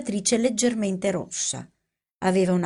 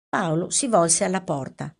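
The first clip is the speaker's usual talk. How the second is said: plain reading voice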